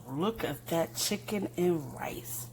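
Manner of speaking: slow, breathy whisper over the sizzling pan. Real, not acted. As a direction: hush it like a secret about the food. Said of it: "Why am I whispering?".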